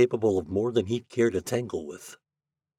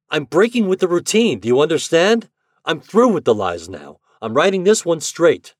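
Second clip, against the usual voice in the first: loud, high vow; angry insistence; 10 semitones higher, 9 dB louder than usual. A narrator's reading. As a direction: hammer the point home. Shandor insists angrily.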